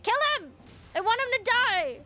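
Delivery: high, loud chant of Kill him; yelling at the TV. Retold or imitated. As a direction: cheer for the kill, shouting. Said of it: "yelling at my T V".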